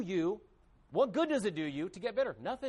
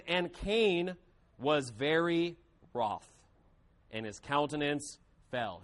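measured, lower scripture reading, slowing at the end; 4 semitones lower, slower than usual. Acted. Preacher reading Genesis to the church, measured and solemn. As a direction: read the verses with weight, slower at the close.

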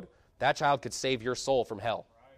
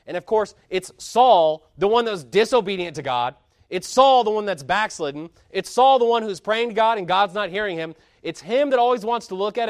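preaching at full force; 5 semitones higher, 7 dB louder than usual. Acted. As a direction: hammer each name out to the congregation.

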